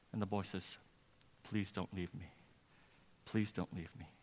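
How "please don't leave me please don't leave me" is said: subdued pleading. Retold or imitated. Frightened boy begging his lawyer to stay, quiet plea. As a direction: plead quietly, with little force.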